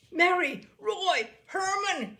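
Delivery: high, loud shout for the family. Acted. Father shouting for his family in alarm, loud. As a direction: yell the names in panic. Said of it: "he shouted".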